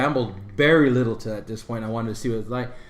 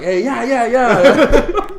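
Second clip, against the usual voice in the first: laughing agreement; 9 semitones higher, 10 dB louder, much slower than usual. Retold or imitated. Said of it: "I was like".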